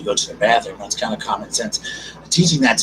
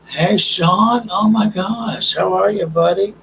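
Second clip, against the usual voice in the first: much higher, louder happy hello; excited greeting; 9 semitones higher, 4 dB louder, slower than usual. Real, not acted. Excited greeting of chat friend.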